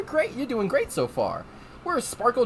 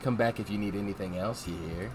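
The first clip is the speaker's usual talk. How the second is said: low, soft, purring lady voice; a sultry invitation, drawn low and soft; 10 semitones lower, 7 dB quieter than usual. Acted. Rouge inviting the train staff back, low and sultry. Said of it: "my sultry lady voice".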